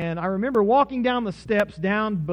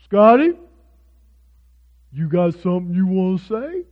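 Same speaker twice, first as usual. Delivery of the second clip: loud coach challenge, rising like a question; Butch; 9 dB louder than usual. Retold or imitated. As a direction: call out a student, imitating the coach. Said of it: "He said".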